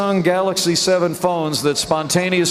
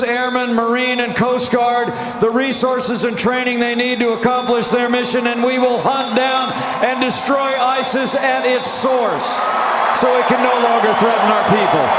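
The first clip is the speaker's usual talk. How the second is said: rally speech; 6 semitones higher than usual. Real, not acted. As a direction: build to a booming rally cry. Rally speech, shouting to crowd.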